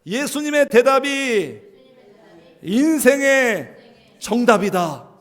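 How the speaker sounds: slow, loud unison call-out; loud slow repeat-after-me; congregation repeats. Shown as a demonstration. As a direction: say it slowly with the people. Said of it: "한번 같이 해주세요".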